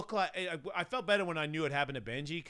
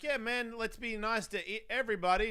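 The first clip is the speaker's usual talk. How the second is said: high, simple-minded plea for niceness; basic me; 10 semitones higher, 4 dB louder than usual. Acted. Speaker voicing his basic self, naively pleading. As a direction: play your naive self, pitched up. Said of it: "basic me over here is like".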